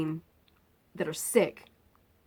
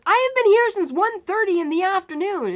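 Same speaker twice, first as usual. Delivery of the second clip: retold yell; 13 semitones higher, 8 dB louder than usual. Patient yelling at the surgeon, retold yell. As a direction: shout it, fed up.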